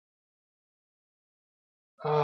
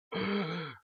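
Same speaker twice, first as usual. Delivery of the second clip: startled sharp gasp; 9 semitones higher, 4 dB quieter than usual. Real, not acted. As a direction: gasp in sudden fright. Sudden danger in game.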